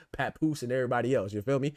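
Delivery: ordinary talk, nothing marked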